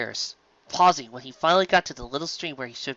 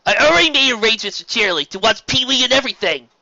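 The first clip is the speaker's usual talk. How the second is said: put-on higher, louder indignant kid voice; louder, raised indignant protest; indignant Spike; 9 semitones higher, 7 dB louder than usual. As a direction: refuse it loudly like a young dragon.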